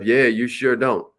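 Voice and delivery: sarcastic. Shown as a demonstration. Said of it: "You're not being sarcastic".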